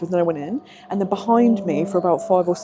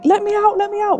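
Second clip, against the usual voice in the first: imitating trapped ghost; 11 semitones higher than usual. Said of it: "someone was going".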